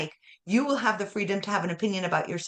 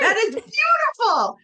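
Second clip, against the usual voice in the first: admiring; 6 dB louder than usual. Real, not acted. Host praises guest's story.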